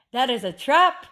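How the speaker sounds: usual speaking voice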